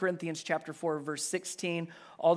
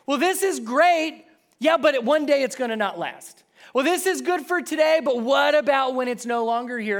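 higher, louder mock-worried musing; anxious inner voice; 8 semitones higher, 9 dB louder than usual. Voicing a child's fear the joy ends, high mock worry.

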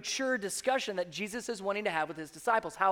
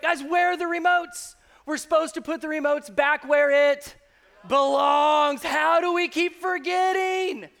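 much higher and louder, slowed at the end; exasperated shout; 6 semitones higher, 7 dB louder than usual. Retold or imitated. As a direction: yell at the family about lost remotes. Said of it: "where it's like".

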